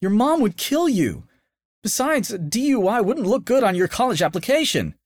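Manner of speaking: high, worried warning; cautious girlfriend. A narrator's reading. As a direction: gently hold him back. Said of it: "cautioned Aiden's girlfriend".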